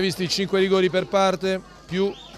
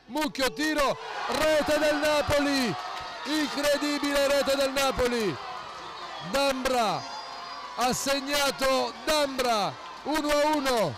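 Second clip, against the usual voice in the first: breathless italian goal commentary; 5 semitones higher, 8 dB quieter than usual. Real, not acted. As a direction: yell the equaliser, stretching the words. Late equaliser goal call.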